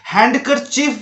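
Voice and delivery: हैंडकरचीफ with the d sounded; spelling-reading of हैंडकरचीफ, audible d; wrong pronunciation. Shown as a demonstration. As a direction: say हैंडकरचीफ as hand-ker-chief. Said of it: "जो कि बिल्कुल गलत है".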